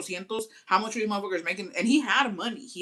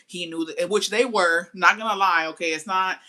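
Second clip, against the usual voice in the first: normal talking voice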